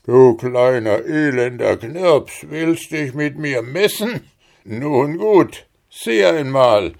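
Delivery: laut und höher, höhnisch drohend; spottender Riese. Acted. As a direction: ein Riese, der den Knirps verlacht. Riese verhöhnt Hans. (höhnischer Riese, laut und höher)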